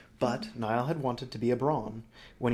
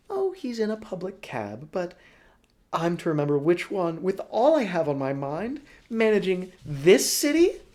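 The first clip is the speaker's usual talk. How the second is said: Broly character voice; 8 semitones higher, 8 dB louder than usual. Acted. Broly gushes to Helva about Niall, high and loud. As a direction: gush over the man as a theatrical old schemer.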